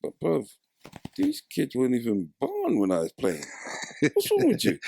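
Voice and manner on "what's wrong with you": mock disbelief. Mock outrage at the foundation.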